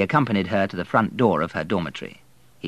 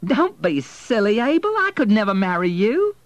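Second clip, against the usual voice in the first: very high, loud, scornful dismissal; incredulous scoff, pitched right up; vehement; 13 semitones higher, 7 dB louder than usual. A narrator's reading. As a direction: reject the proposal with vehemence. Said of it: "vehemence in her voice".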